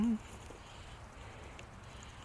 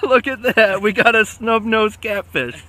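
higher and louder, thrilled; excited; 8 semitones higher, 7 dB louder, slower than usual. Real, not acted. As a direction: show off the odd fish, delighted. Showing off the snub-nosed catch.

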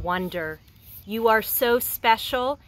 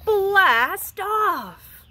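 higher and louder, launching into space; excited blast off; 9 semitones higher, 5 dB louder than usual. Acted. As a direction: shout it like a rocket lifting off. Rocket launch in the story.

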